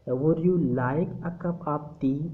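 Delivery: slow, pitch rises on tea; rising intonation. Shown as a demonstration. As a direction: ask it slowly with tea lifting up. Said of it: "अपनी आवाज़ की pitch को थोड़ा सा ज़्यादा कर देते हैं". (चाय पर उठती आवाज़ वाला धीमा सवाल)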